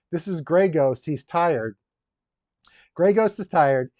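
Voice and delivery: normal talking voice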